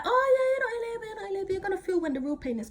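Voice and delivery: high, fast sing-song imitation of mum; sing-song dismissive mum voice; mocking mum. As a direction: brush it off in a teasing sing-song. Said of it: "My mum's like".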